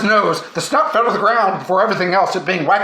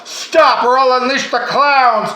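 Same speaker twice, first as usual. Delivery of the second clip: slow, higher, louder threat; hammed-up villain warning; yelled threat; 5 semitones higher, 4 dB louder, slower than usual. A narrator's reading. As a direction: call the threat out slowly across the field. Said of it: "he heard someone yell".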